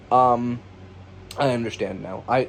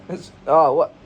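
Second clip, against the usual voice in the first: startled; 4 semitones higher, 6 dB louder than usual. Acted. Mock startled reaction.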